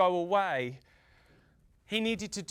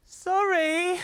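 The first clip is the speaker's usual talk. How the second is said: high, loud little sorry; a bright apologetic squeak; sun apologising; 8 semitones higher, 4 dB louder than usual. Acted. Sun apologising to the angry farmer, high and loud.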